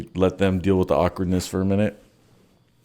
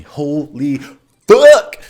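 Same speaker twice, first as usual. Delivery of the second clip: shocked shout; 4 semitones higher, 8 dB louder, much slower than usual. Retold or imitated. The stench suddenly hits him.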